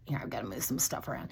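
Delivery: quick whispered aside to herself; hushed muttered aside. Real, not acted. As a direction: drop to a fast whisper while shuffling things. Aside while moving things.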